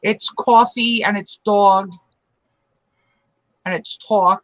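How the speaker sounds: "coffee", "dog", "talk" with a long rounded aw, slow; Philly-area vowels. Shown as a demonstration. Showing friends the north-of-Philly aw vowel, slow. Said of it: "the reason I asked you to say that word".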